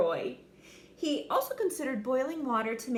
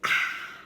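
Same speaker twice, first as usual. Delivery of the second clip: gasp; 4 dB louder than usual. Acted. Dragon tries to breathe.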